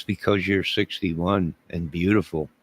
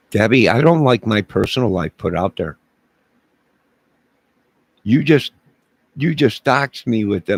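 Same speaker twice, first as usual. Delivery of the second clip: mock outrage; 4 semitones higher, 6 dB louder than usual. Mock outrage at chatter Debbie.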